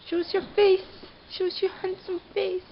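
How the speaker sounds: high coaxing pet voice; squeaky praising a dog. Real, not acted. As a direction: coax the dog sweetly.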